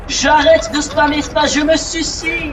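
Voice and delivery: loud, overdone fake despair; mock despair. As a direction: wail it like a drama queen. Joking despair over the game.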